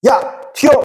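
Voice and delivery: high-pitched acted cry to run; urgent warning call. Teacher showing the key word comes first, urgent high call. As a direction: sound the alarm, pitched well up.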